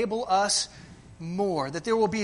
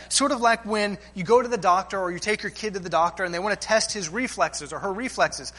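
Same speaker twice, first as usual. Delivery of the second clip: ordinary talk, nothing marked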